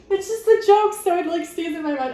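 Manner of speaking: high, loud giggling words; laughing through it, can't hold it in. Real, not acted. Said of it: "I'm trying not to laugh".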